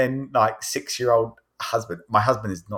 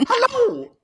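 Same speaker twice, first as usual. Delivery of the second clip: very high cartoon squeak; playful falsetto mouse greeting; Mickey Mouse; 3 dB louder than usual. Mother's other voice for the child, silly high cartoon. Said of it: "Or like Mickey Mouse".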